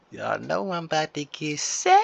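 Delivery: playful high-pitched teasing; silly voice. Shown as a demonstration. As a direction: play it goofy and lifted, like a tease.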